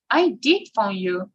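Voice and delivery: "did" punched for emphasis; emphatic "did" in a rhythmic model sentence; stress on DID. Shown as a demonstration. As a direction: insist with a strong "did". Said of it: "it is always stressed".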